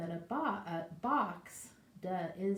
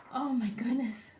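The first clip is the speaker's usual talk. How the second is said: whispered amazement; awed whisper; 6 semitones higher, 5 dB louder than usual. Real, not acted. Reacting to picture for kids.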